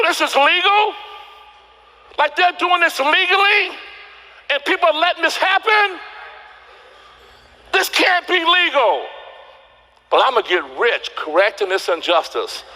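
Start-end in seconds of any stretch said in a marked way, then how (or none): 0.0-9.1 high, incredulous outcry; outraged disbelief. Retold or imitated. Himself at the kitchen table, high incredulous outrage. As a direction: rail at the injustice in disbelief. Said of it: "I go".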